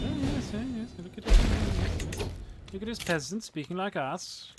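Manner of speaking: mock snooty gasp at the peasant; surprised posh people. Mocking how people react to his accent, high and snooty. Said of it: "So people are always surprised".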